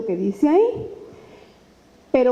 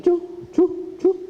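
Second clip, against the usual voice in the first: light snore noises for dozing listeners; mock snoring sound; 7 semitones higher, slower than usual. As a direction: puff out little sleepy snores. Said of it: "van cayendo así dormidos".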